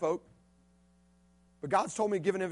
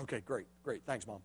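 much softer, quick thanks; brushing it off; 9 semitones lower, 13 dB quieter than usual. Retold or imitated. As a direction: mumble thanks and leave. Boy brushing it off.

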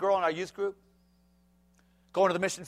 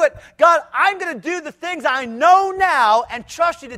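raised, louder preaching voice; 8 semitones higher, 9 dB louder than usual. Acted. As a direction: preach it hard to the congregation. Preacher at full force.

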